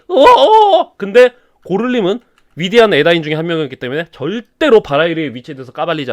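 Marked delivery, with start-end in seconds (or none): 0.1-0.9 higher laughing mock growl; hammed-up villain growl; monster roar. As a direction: growl like a gleeful beast. Sauron's roar.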